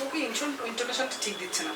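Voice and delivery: soft under-the-breath director's note; quiet grumble of a director watching an actor; muttered note to self. Retold or imitated. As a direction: mutter the complaint quietly, half to yourself. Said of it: "তখন খালি বলছি".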